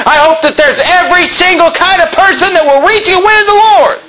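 high-pitched fervent pulpit shout; impassioned preaching. Real, not acted. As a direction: preach it at full force, piling up the list. Preacher builds to impassioned shouting list.

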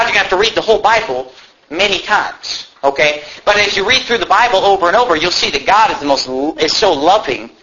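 normal talking voice

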